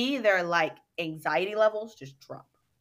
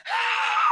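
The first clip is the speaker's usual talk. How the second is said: a very high, loud scream let fully out; full-throated yell; 5 dB louder than usual. Retold or imitated. Women in the dance class finally letting anger out, screaming. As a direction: scream it with total release. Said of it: "yelling at the top of their lungs".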